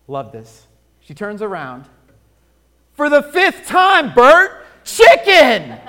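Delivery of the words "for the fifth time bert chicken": loud, high, fed-up yell; wife yelling at her husband after the fifth question, exasperated; exasperated shout. Acted. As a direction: bellow the answer at him, out of patience.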